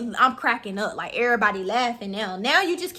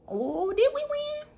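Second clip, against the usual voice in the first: puzzled; slower than usual. Real, not acted. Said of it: "I don't even remember".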